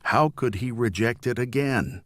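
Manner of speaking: ordinary delivery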